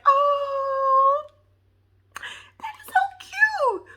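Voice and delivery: high drawn-out squeal fading to a squeaky whisper; mock-tearful cooing; cheesing. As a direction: gush as if melting at something adorable. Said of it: "I was in here cheesing".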